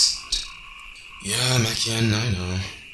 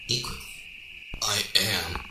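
normal talking voice

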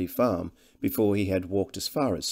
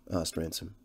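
usual speaking voice

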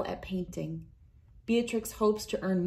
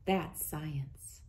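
a soft whispered aside, breath only; 4 semitones lower, 6 dB quieter than usual. A narrator's reading. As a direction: lean in and whisper it like a secret. Narrator's hushed closing remark.

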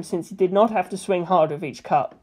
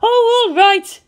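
much higher and louder exasperated retort; retorted hotly; 7 dB louder, slower than usual. A narrator's reading. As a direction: snap back, giving in hotly. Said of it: "she retorted hotly".

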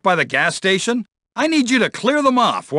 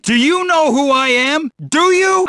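rising to a shrill, louder outraged yell; indignant yell; 9 semitones higher, 4 dB louder than usual. Acted. To a kid who bumped him, outraged yell. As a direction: bellow his own importance at the kid. Cop enraged at being bumped.

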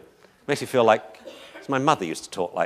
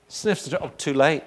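ordinary talk

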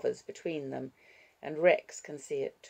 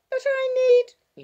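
put-on very high, loud little-girl cry; outraged child; 13 dB louder than usual. Six-year-old whose pillow was thrown away, outraged child. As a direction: protest at full pitch as a small child.

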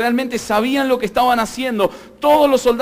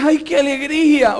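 mock delighted cry; 4 semitones higher than usual. People happy to see you.

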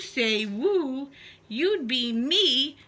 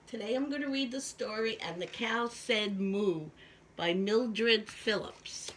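ordinary delivery, nothing marked

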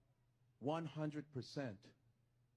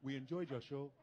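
lightly imitated Nigerian accent, lower pitch; 5 semitones lower than usual. Nigerians greeting the comic after his show, Nigerian accent. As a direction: put on a low Nigerian accent. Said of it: "that's how Nigerians talk".

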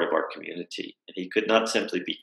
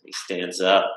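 ordinary talk, nothing marked

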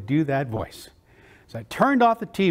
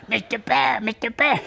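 higher, half-formed cartoon duck; duck voice attempt; 4 semitones higher than usual. Boy tries the voice out on his mom, rough duck attempt. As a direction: show off an unfinished duck voice. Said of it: "I tried it out for my mom".

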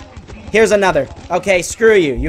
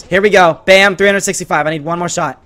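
usual speaking voice

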